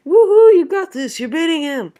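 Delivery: high, loud whooping cheer; excited cheer. Acted. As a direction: whoop him on to win. Cheering at contest.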